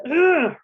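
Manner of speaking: high, loud frustrated "Ugh"; jaw-tight angry ugh; angry growl. Shown as a demonstration. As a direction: let out a mammalian grunt of anger.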